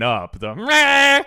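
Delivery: loud, high strained mock singing; mocking singer. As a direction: belt out meh like a lazy pop star. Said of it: "Or did he was like".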